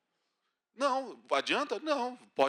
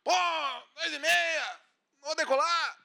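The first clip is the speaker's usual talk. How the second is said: high, loud indignant protest; angry passenger complaint; 11 semitones higher, 5 dB louder, slower than usual. Retold or imitated. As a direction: rant at the late flight. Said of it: "tu começa a fazer um escarcéu".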